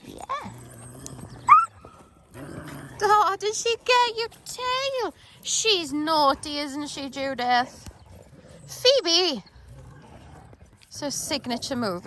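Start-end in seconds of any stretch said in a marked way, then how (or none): 0.1-7.9 pet talk voice. Real, not acted. Baby talk to dogs. 8.7-9.4 calling out. Real, not acted. Calling the dog.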